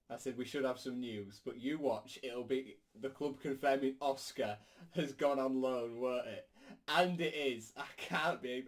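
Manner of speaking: ordinary delivery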